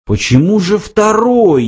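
high-pitched pressing question; insistent. A narrator's reading. Lunkwill will not let the computer off, insistent. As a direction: keep pushing, pitch leaping up. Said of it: "не унимался Ланквилл".

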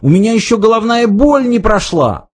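high, plaintive protest; crying out. A narrator's reading. Arthur complaining to his captors, crying out high. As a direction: wail it high, without real shouting. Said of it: "закричал Артур".

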